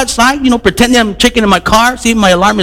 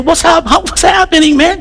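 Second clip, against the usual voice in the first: overly friendly greeting; 9 semitones higher than usual. Retold or imitated. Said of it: "I'm a little too friendly".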